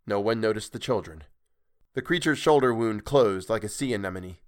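usual speaking voice

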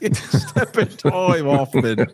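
slow, high Cockney through laughter; mock Cockney, laughing. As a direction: giggle through a hammy Cockney.

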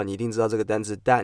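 ordinary talk, nothing marked

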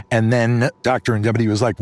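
usual speaking voice

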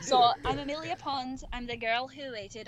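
put-on Scottish accent, in character. Shown as a demonstration. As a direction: do a Scottish lass introducing herself. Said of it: "I had to do my Scottish accent".

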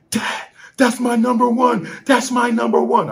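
imitating excited son. Son telling his dad about his favourite ride, high and thrilled. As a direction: squeal it like a thrilled kid. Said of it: "he was like".

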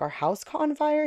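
high, rather quiet incredulous question; retold disbelief. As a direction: lift the pitch as if unable to believe it. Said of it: "it's almost like you're in disbelief".

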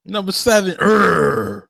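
high-pitched hype holler ending in a noise; mock hype-man echo. Friend joins the drive-thru joke, mock hype holler with sound effect. Joins in mimicking hyped host.

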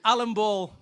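ordinary talk

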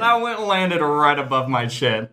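ordinary talk, nothing marked

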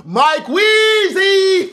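high, loud, stretched-out shout of a name; drawn-out holler. Real, not acted. Podcast host thanking a new patron by name, drawn-out holler.

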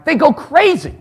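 ordinary talk, nothing marked

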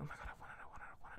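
excited whisper to oneself; retold craving, barely voiced; hushed, repeated wanting; whispered longing.

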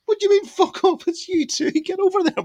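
high, loud, urgent shove of words; excited insistence. Retold or imitated. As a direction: push your mate over the barrier with your voice. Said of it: "I was like".

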